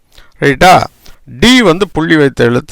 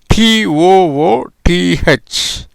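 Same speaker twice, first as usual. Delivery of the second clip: slow spelling out; 3 semitones higher, much slower than usual. Shown as a demonstration. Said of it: "spelling out the word".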